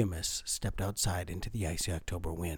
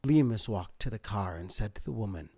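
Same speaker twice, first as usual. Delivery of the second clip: ordinary delivery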